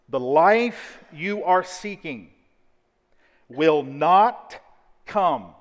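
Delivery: slow, weighty word-by-word statement; measured preacher, leaning on key words; slow, deliberate. Shown as a demonstration. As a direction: spell it out slowly with long pauses. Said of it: "to unpack slowly for you".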